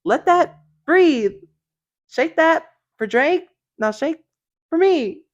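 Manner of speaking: high, loud, drawn-out mock rap delivery. Host quoting vulgar lyrics for laughs, high imitation. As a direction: pitch it up and punch each short phrase. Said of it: "She's like".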